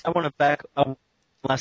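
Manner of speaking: robotic, choppy connection; glitching audio. Real, not acted. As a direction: voice breaking up online.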